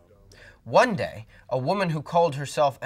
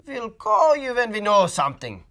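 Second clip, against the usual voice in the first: higher, louder doctor promising; brisk expert brushing off the family; 9 semitones higher, 10 dB louder than usual. A narrator's reading. Doctor Gourd voiced by narrator.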